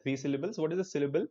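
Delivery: ordinary talk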